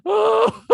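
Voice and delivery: very high, loud "Oh" yelp; high exclamation. Shown as a demonstration. Showing his catchphrase to his cohost, a loud high yelp. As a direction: squeal the "Oh" way up high. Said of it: "Just going".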